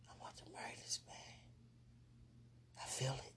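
very soft fast whisper; faint whisper. Real, not acted. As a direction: barely breathe it out quickly. Whispered gushing.

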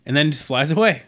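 ordinary talk, nothing marked

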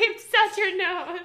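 slow words through laughter; giggling while teasing; laughing. Real, not acted. Laughing at snort.

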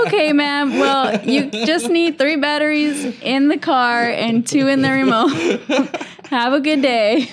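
giving up politely. Retold or imitated. Agent ending a hopeless battery call, politely exasperated. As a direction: wrap it up brightly, barely holding in laughter. Agent gives up on arguing caller.

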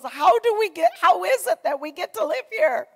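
high, loud wonder at their blessing; husband's amazed delight. Retold or imitated. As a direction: mimic him marvelling at the lake house. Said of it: "and he goes".

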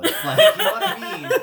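high, loud laugh-talk. Real, not acted. GM laughing about the wrong NPC accent, high and loud. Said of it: "not the right accent for an assassin".

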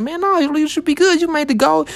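high, breezy mock reassurance; imagined easygoing reassurance. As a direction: toss it off like it's no big deal. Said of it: "he was gonna, like, be like".

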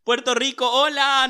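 loud, high greeting; excited shout-out. Real, not acted. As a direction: call out to Puerto Rico. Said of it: "shout-out to listeners".

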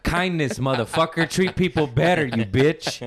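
high, hammed-up scolding; mock furious comeback. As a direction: tell off the manager in pretend outrage.

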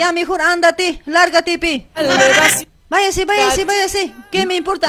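high-pitched, impatient shooing; angry dismissal. Acted. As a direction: send them packing in a staged row. Acted quarrel, sending him away.

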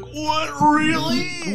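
put-on squeaky shocked little kid; high shocked child. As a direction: gasp it like a naive child. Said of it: "I would immediately be like".

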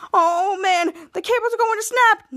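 acted alarm. Kid Danger sees the cables about to snap, alarmed. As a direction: warn them with rising, play-acted worry.

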